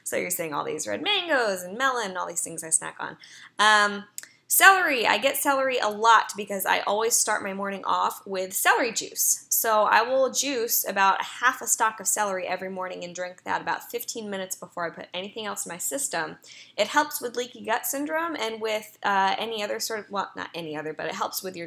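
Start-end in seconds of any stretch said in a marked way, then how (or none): none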